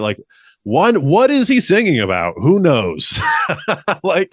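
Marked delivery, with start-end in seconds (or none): 3.3-4.0 brief amused laugh; a quick real chuckle; short laugh. Real, not acted. Laughs at the lyrics.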